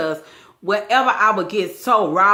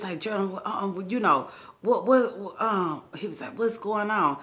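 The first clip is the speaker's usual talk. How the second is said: faked weak mumble; 7 dB quieter than usual. Man stammers, acting hurt.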